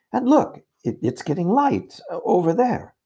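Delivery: put-on high-pitched, amazed girl; excited discovery. A narrator's reading. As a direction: point it out eagerly, voice leaping up. Susan discovers light among trees.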